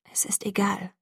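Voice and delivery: hushed, half-whispered thought; inner thought. A narrator's reading. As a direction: say it under your breath, to yourself. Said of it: "wiederholte ich in Gedanken".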